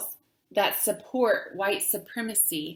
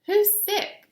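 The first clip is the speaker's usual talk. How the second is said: put-on much higher, louder puzzled little girl; curious child; 12 semitones higher, 6 dB louder than usual. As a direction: ask like a confused small kid. Child asks confused question.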